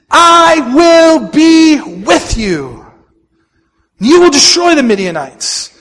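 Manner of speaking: high, loud, slowly stretched declaration; rallying proclamation. Acted. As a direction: proclaim it for a congregation to join in. Leads congregation reading God's promise aloud.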